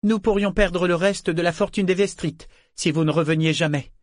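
sceptical. A narrator's reading. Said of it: "si j'ai l'air si sceptique".

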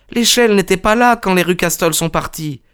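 put-on high-pitched young governess; protesting. A narrator's reading. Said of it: "protesta mademoiselle Hunter". (protestation aiguë de la gouvernante)

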